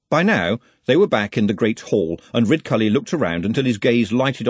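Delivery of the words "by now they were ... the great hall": ordinary delivery, nothing marked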